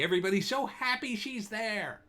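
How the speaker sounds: high, singsong fake happiness; mock delight. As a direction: gush with sugary, put-on cheer. Mocking upbeat PR story.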